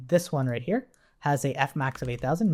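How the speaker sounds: usual speaking voice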